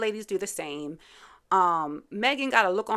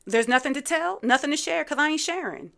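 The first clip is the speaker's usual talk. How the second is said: mock defensive; 6 semitones higher, 5 dB louder than usual. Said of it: "it's like she's short-circuiting".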